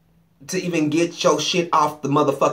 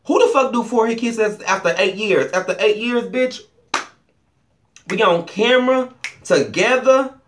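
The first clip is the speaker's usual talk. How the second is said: mock outraged quote; 7 semitones higher than usual. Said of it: "He said".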